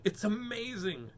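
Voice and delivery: slow, high storybook amazement; excited exclamation. A narrator's reading. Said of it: "exclaimed Henry".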